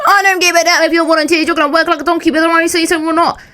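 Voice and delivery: high, loud mocking rant as the angry boss; furious boss. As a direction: bark it like a furious boss at lazy volunteers. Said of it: "He would get so pissed off".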